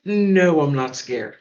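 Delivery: ordinary delivery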